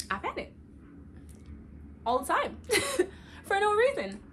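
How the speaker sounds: laughing through high squeals. Real, not acted. Laughing at her own constant panicking, high squeals. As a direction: giggle it out, pitch jumping up.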